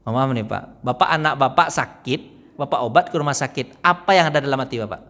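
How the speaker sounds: normal talking voice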